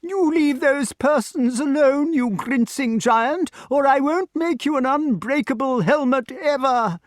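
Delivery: very high scolding character voice; high-pitched, indignant protest; indignant inventor. A narrator's reading. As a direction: shrilly tell off a bully. Inventor scolds giant from cell.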